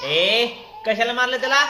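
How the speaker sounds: loud, high call-out and challenge; stern scolding. Real, not acted. Parent scolds child for hitting.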